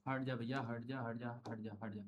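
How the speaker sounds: very low, soft shooing mutter; hushed aside. Real, not acted. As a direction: drop right down to a private murmur. Quiet shooing aside mid-lesson.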